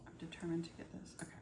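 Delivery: quick determined whisper. Real, not acted. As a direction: whisper it to yourself. Struggling with a piece.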